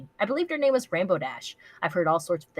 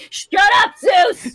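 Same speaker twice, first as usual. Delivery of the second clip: loud, high shout with a laugh; real shout; 14 semitones higher, 13 dB louder, much slower than usual. Real, not acted. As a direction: yell at the pet to hush. Yelling at the pet mid-reading.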